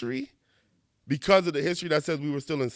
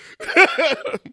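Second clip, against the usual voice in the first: loud high burst of laughter; 14 semitones higher, 7 dB louder than usual. Real, not acted. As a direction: let the laugh spill out high. Laughing at red-flag posts.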